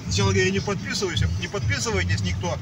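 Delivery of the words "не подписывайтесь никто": ordinary talk, nothing marked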